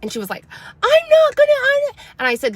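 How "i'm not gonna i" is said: put-on high, loud child protest; protesting kid. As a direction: whine back at mom. Daughter protests.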